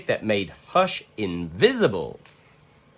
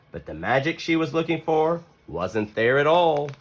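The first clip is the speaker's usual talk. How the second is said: plain reading voice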